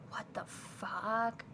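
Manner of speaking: drawn-out, whispered disbelief; stunned disbelief. Retold or imitated. Recalling being pushed to take free Spotify, stunned whisper. Retelling own stunned reaction.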